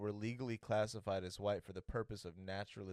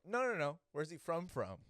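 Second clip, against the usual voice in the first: higher-pitched, lightly acted interruption; mock insistent; 6 semitones higher than usual. Questioner cutting off an evasive answer, mock insistent. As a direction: cut in to press the question.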